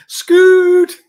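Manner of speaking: laughing high shout of Scoot!; shouted name. Pretend. Greeting Scott on air, a loud laughing shout. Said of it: "With the Irish accent".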